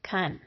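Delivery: "kun" with a reduced uh vowel, unstressed; mushed to uh. Shown as a demonstration. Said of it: "it will be mushed to an uh".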